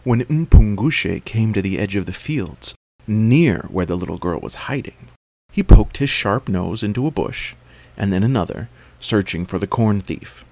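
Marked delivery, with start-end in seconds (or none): none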